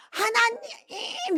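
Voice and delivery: high, slow, drawn-out call with a laugh; joyful calling out. Retold or imitated. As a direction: call out to a newfound father. Child calling God in church. (기쁘게 길게 부르는 외침)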